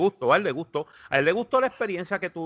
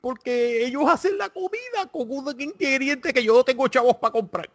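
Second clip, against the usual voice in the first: much higher mock whiny voice; 10 semitones higher than usual. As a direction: squeak it like a silly complainer. Mocking someone needing recipe.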